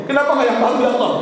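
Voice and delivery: high, insistent imitated lament; retold delirious plea. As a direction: repeat it pleadingly, pitched right up. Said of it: "suamiku meracau".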